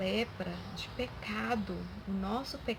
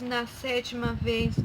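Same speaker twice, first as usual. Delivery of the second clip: ordinary delivery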